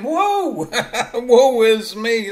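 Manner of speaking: high, loud, drawn-out mock wail; hammed-up silent-movie damsel, laughing through it; mock damsel's despair. Preacher mimicking a girl tied to railway tracks, mock despair. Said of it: "she, she's saying".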